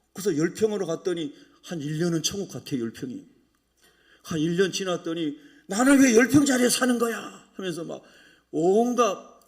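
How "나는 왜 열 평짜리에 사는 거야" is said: loud, raised-pitch grumble; mock outburst of discontent; acted resentful complaint. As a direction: complain as if hard done by. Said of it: "원망과 불평이 다 쏟아지는 거예요".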